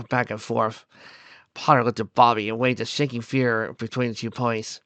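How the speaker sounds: ordinary delivery, nothing marked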